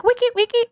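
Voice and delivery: high-pitched "wiki, wiki" scratch noise; imitated record scratch. Previewing a Wacky Wednesdays intro, high mock scratch.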